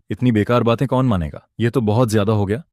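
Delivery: usual speaking voice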